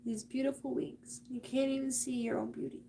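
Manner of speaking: real, quiet choked-up tenderness; holding back emotion. Real, not acted. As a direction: speak softly while fighting back tears. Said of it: "I'm trying not to get emotional".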